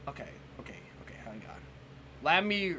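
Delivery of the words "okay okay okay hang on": whispered muttering. Real, not acted. Muttering while reading name.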